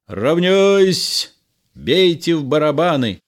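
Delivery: high, loud parade order; shouted military command. A narrator's reading. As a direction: shout it to the whole company. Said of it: "Карбон кричит".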